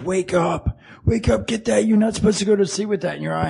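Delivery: urgent wake-up. Retold or imitated. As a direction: imitate her pestering someone awake. Acting out her wake-up demand.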